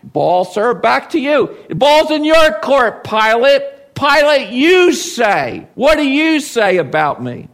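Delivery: ball's in your court, hit it back hard; forceful challenge. Acted. Jesus tossing the question back to Pilate, forceful and loud. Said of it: "he's saying".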